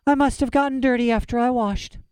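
put-on high, innocent girl making an excuse; girl's voice. A narrator's reading. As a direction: pitch up into a child's voice.

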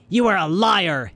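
high, furious villain's accusation; hissed accusation. A narrator's reading. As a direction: spit out the charge angrily. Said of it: "he hissed at Violet".

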